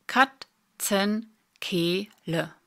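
"Kat, zen, ke, le" split into separate syllables. Shown as a demonstration. Teacher showing where syllables end, "Kat, zen, ke, le" syllable by syllable. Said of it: "durch das Ende der Hauptsilbe bestimmt".

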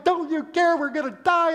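high-pitched loud mock panic; panicked yelling. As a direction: cry out as Peter in a storm. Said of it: "people are yelling and all this stuff".

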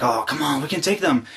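a touch of eager urging, pitch rising; lightly voiced eager warrior; lightly acted Gimli. Dwarf urging his friend to attack, lightly acted eagerness.

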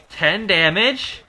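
loud, high, slow, rising disbelief on "damage"; incredulous slow question. Real, not acted. Complaining about a weak hit in the game, slow, loud disbelief.

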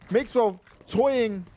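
usual speaking voice

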